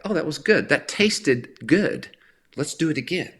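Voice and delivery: imitating a satisfied taster; savoring delight. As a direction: relish it like a great bite of food. Acting out savoring good fruit.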